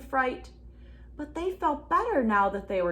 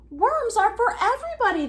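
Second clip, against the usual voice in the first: much higher, louder protest; crying out; 12 semitones higher, 6 dB louder, faster than usual. A narrator's reading. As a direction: cry out that worms are shared. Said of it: "they cried".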